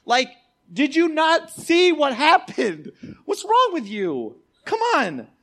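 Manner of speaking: high, slow, incredulous outburst; exasperated disbelief. Acted. Preacher to leaders who ignored the miracle, exasperated disbelief. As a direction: berate them as if they missed the obvious.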